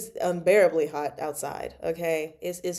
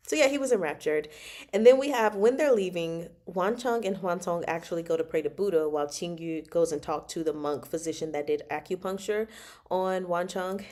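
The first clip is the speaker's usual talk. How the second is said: ordinary talk, nothing marked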